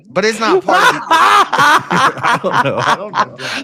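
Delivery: giggling embarrassed admission; laughing. Real, not acted. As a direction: crack up as you admit you don't know. Caught out, laughing at himself.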